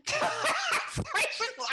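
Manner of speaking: high, helpless burst of laughter; laughing. Real, not acted. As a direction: try to speak through real laughter. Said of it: "That's why I'm laughing".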